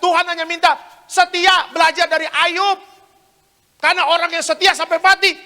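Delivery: loud, high, impassioned preacher; full-force preaching. Acted. Preacher urging the congregation to stay faithful to God, full-force preaching. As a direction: preach it out over the whole hall.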